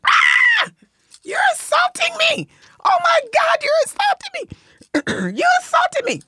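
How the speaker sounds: hammed-up cry of assault; mock screaming. Reenacting yelling at the woman who touched her hair, high shouting. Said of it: "I screamed".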